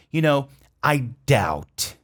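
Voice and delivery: "you know, I doubt" drawn out slowly; slow, careful demo. Shown as a demonstration. Teacher modelling the filler for students. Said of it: "Olha a pronúncia".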